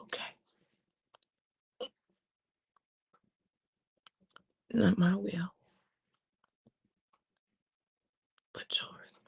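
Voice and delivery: barely audible, drained acceptance; hushed surrender. Acted. Jesus yields to his Father, hushed and drained. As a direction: let go, almost no breath left.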